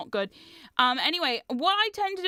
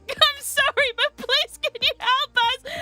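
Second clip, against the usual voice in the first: high, loud plea through giggles; laughing apology; 12 semitones higher, 5 dB louder, slower than usual. Retold or imitated. Awkward teen to chainsaw actor, pleading through laughter. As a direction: beg helplessly while cracking up. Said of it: "So I start laughing".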